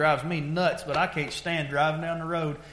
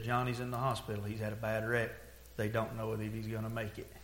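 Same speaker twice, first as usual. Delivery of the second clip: low, hushed retelling of bad news; subdued, quiet phone-call report; grave news; 5 semitones lower, 10 dB quieter than usual. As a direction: relay terrible news softly and heavily.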